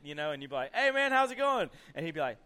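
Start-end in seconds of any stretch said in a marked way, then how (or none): 0.7-1.7 high, loud retold hello; loud greeting. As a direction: call out a hearty greeting.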